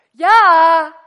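a huge, high shout of yes; a yelled reply from below; loud answer. Retold or imitated. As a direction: bellow back from downstairs. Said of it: "toen kwam het antwoord van beneden".

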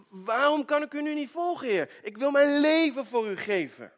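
high, loud, earnest pleading; pleading Peter. Retold or imitated. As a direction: insist you would die for him. Peter protests to Jesus.

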